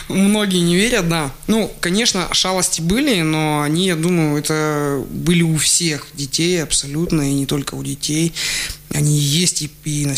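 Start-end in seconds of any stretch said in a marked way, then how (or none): none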